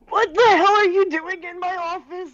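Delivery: put-on loud, cranky old man. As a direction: grumble like a grumpy old professor. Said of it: "Here's my old man voice".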